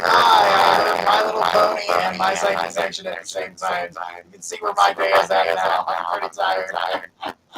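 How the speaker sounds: deep garbled robot voice. Real, not acted. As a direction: drop into a distorted robot mic. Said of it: "You sound like a robot".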